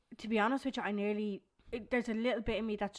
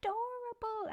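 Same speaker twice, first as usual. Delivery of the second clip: put-on much higher excited little boy voice; whiny tattling little sibling. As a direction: squeal it like an eager small boy. Mum voices son about baby.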